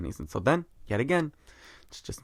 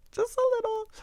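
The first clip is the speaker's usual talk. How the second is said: tiny squeaky voice, much higher. Pretend. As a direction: squeak it out cutely. Squeaky aside after repeat.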